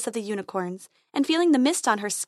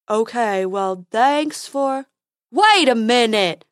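troll Fred; 2 semitones higher, 7 dB louder, slower than usual. Acted.

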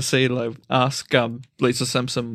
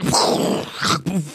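whooshing explosion noise made by mouth; imitated rocket explosion; 2 semitones higher than usual. Shown as a demonstration. Showing friends how awesome the RPG is, imitated explosion. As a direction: make a voiceless RPG blast.

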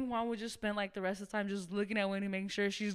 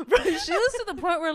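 laughing; 9 semitones higher, 9 dB louder than usual. Real, not acted. Laughing while telling.

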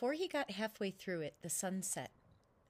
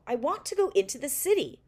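louder, higher insistent plea; insistent demand; 6 semitones higher, 9 dB louder than usual. Acted. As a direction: demand it like a child who won't take no. Boy insists after refusal.

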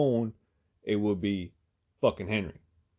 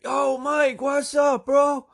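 high, loud imitated clerk greeting; hollering back; 9 dB louder, much slower than usual. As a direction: shout back to an arriving buddy.

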